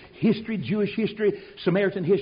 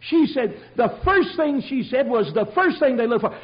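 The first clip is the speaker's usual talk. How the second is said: normal talking voice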